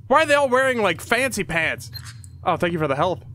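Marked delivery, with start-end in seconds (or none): none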